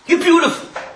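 emphatic, swooping-high compliment; exclaimed admiration. Retold or imitated. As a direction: marvel aloud at someone's beauty. Acted quote admiring the rabbi's beauty.

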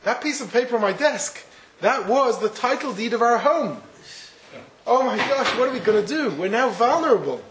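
mild hammed-up panic from the father; alarmed father. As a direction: let the father realise and panic. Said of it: "the father comes home and says".

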